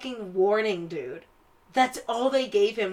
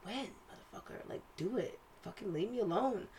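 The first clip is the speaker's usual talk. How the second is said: muttered under the breath, much softer; inner grumble; 13 dB quieter than usual. Retold or imitated. Coworker grumbling inside at a clingy colleague, muttered. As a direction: swear quietly to yourself. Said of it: "in my mind, I was like".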